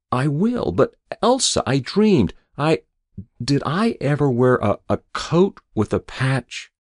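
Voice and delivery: high, hesitant, insistent patient; confused waking patient. A narrator's reading. Patient asking his wife about a dream, high halting voice.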